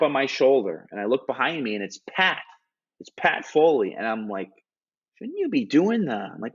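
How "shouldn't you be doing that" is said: pitched-up, baffled question; higher, startled puzzlement; puzzled surprise. Retold or imitated. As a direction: ask it as if caught completely off guard. Confused at Pat's tap mid-show.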